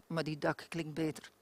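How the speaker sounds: ordinary delivery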